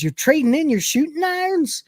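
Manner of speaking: very high-pitched incredulous question; shocked saloon woman. Acted. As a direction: squeal it in mock astonishment.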